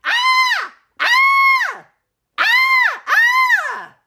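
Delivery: repeated high panicked shrieks; scared screams. Acted. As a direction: scream your head off. Everyone screaming in fright.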